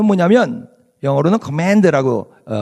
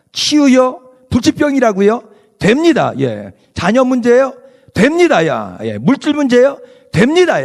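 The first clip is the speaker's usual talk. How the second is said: 높고 크게 외치는 설교; 확신에 차서 부르짖듯; fervent preaching; 8 semitones higher, 4 dB louder than usual. Acted. Preaching call and response. (열정적으로 외치는 설교)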